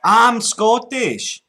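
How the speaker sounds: put-on loud, slow, high Scottish accent; put-on Scots, drawn out and loud; broad Scottish accent. Prank caller to scammer, broad Scottish.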